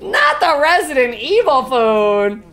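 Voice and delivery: loud, slow, drawn-out dismay; mock horror. Player finding rotten food, dramatically appalled. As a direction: wail it slowly in mock disgust.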